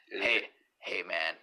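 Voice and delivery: very low, soft superhero voice; Batman. Acted. Host demonstrates an impression, low and understated. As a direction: drop it deep and quiet. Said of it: "my Batman voice would be like".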